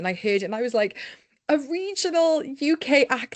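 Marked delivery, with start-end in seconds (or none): 1.5-3.4 excited. Retold or imitated. Excited at hearing regional voice.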